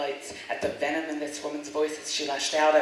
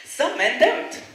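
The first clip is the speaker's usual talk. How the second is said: loud, defiant poem delivery; defiant recital; 8 semitones higher, 9 dB louder than usual. Acted. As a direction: recite with rising fury. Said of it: "I perform my poem defiantly".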